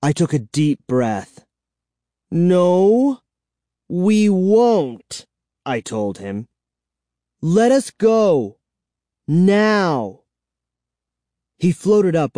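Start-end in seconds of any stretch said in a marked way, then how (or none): 2.3-5.3 defiant. A narrator's reading. Narrator refuses the vampire. 7.4-10.2 demanding. A narrator's reading. Narrator demands release.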